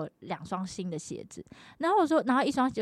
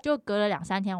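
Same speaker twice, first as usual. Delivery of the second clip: ordinary talk, nothing marked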